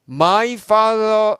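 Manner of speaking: my father drawn out slowly, high and loud; slow emphatic. Acted. Stressing Jesus' words. (회중에게 my father를 높고 크게 천천히 강조)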